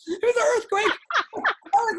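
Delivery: high, loud words through laughter; laughing. Real, not acted. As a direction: crack up at your own joke while talking. Cracking up at own joke.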